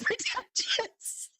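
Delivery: ordinary delivery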